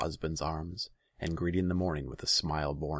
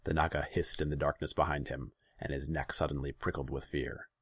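ordinary delivery, nothing marked

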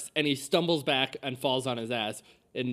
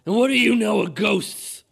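put-on loud, raised old-man challenge; agitated old man; 6 semitones higher, 10 dB louder than usual. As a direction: demand it like a worked-up elderly villager. Frantic old man confronts a player.

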